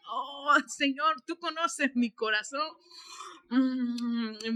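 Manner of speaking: slow, drawn-out sleepy excuse; mock weary prayer. Tired believer excusing himself at prayer hour, mock-weary. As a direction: sigh out a half-hearted prayer.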